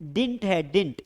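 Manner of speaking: didn't as a one-syllable "dint"; correct pronunciation. Shown as a demonstration. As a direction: repeat didn't as "dint", dropping the middle vowel. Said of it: "इसका सही pronunciation है".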